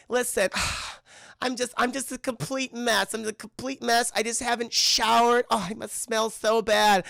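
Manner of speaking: high, whiny, tearful lament; imitation of a weepy mess; mock self-pity. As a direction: whine as if falling apart. Voices upset Penny calling herself a mess.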